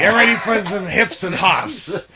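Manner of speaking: upbeat hype-man voice; hammed-up cartoon cat; cartoon rapper cat. Continuing Scat Cat voice.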